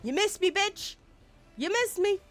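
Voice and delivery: high-pitched mocking jeer; gleeful taunt. Taunts game enemy after its miss.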